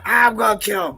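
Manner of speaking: furious mom on phone. Retold or imitated. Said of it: "She called me".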